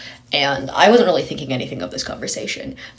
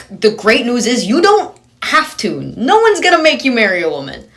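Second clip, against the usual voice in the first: sarcastic reassurance; 8 semitones higher, 6 dB louder than usual. Retold or imitated. Speaker mockingly reassures the woman nobody will force her, over-sweet sarcasm. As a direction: talk her down like a child with mock cheer.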